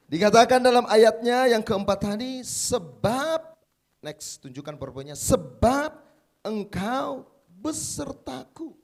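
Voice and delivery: loud, high preaching at full force. Acted. Pastor to the congregation, fervent loud preaching. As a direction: proclaim it to the whole church.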